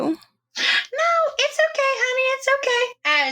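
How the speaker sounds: high, gentle reassurance; calm the scared patient sweetly; soothing. Acted. Nurse soothing frightened patient.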